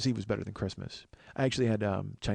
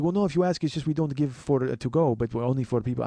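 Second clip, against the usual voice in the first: higher, louder mock Russian-accented clerk; accidental Russian accent; 3 semitones higher, 5 dB louder than usual. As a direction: slip into a rough Russian accent while explaining. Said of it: "I know he's Russian all of a sudden".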